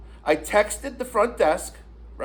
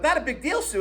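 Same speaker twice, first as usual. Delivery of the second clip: high-pitched, indignant echo; retold incredulous echo; 8 semitones higher than usual. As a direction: throw the words back in disbelief. Echoes her words back in disbelief.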